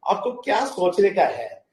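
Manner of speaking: ordinary talk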